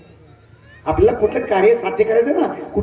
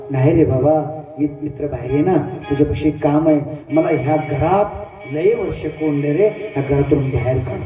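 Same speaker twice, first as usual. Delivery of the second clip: pleading snake; 6 semitones lower than usual. Acted. Snake begs the mouse to free it, wheedling. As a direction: beg sweetly to be let out. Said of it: "snake pleads to be freed".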